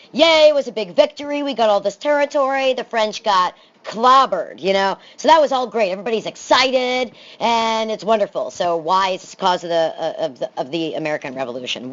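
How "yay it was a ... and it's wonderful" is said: higher, upbeat voice cheering the victory; mock cheer. Teacher to class, hyping the colonial victory with mock excitement. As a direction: sell it like a big win, playfully.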